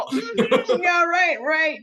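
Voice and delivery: loud, high mock brush-off; sarcastic yeah right. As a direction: wave it off loudly, not buying it. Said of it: "you go".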